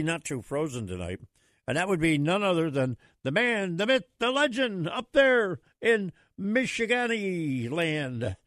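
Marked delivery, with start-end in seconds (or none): 1.7-8.4 hyped announcer intro. Acted. Host bigging up the guest from Michigan, booming announcer voice. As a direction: roll it out like a ring announcer for the caller.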